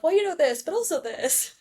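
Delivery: high-pitched mock lawyer weighing both sides; arguing lawyer. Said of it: "lawyers arguing all the cases".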